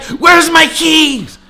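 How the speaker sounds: very high, loud, drawn-out imitation; mimicked shrieking demand. Girl gathering her things demands keys, mock shouting. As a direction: stretch it out as an outraged girl's yell.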